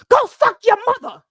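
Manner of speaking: high, loud mock tough-guy insult; mock gangster snap. Imitating the host turning rough on a viewer. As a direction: bark it like a rapper diss. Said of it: "she turned into [name] ninety-seven".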